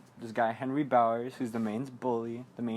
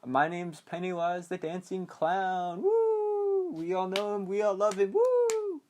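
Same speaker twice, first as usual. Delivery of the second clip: slow, higher-pitched clown introduction with high whoops; showy clown; 6 semitones higher, slower than usual. Retold or imitated. Pennywise introduces himself to Georgie, showy clown voice. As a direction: announce yourself like a circus act, whooping high.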